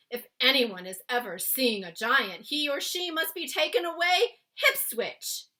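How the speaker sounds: big, loud friendly giant explaining. Acted. Giant explaining to Sophie why he took her. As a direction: boom it out like a kindly giant. BFG voiced in read-aloud.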